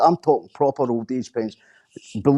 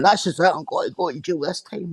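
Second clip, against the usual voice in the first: higher, mock self-pitying boast; mocking braggart; 5 semitones higher than usual. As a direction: parody his empty threat, high. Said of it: "you'll be sitting there going".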